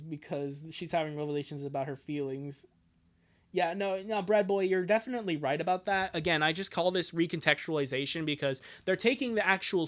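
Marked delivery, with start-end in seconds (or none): none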